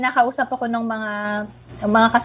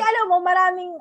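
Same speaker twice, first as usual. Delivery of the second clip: usual speaking voice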